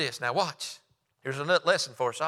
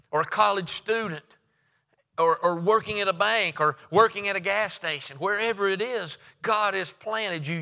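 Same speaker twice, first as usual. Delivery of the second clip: a touch higher, stirring sermon voice; rising preaching; 4 semitones higher than usual. Acted. Preacher rallying workers of every kind, stirring. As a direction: lift them toward the Lord.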